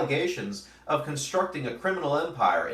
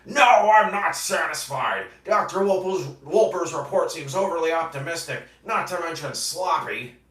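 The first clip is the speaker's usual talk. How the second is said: stern commissioner; 6 semitones higher, 5 dB louder than usual. Acted. Commissioner Gordon quoted from comic.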